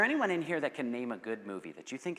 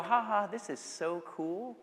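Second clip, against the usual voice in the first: slow, high pretend gushing; imitating mindless, giggly approval; mock amusement; 6 semitones higher, slower than usual. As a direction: parody a delighted audience, drawn out. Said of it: "And we go".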